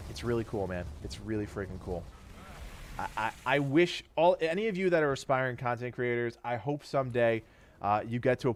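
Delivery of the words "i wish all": ordinary delivery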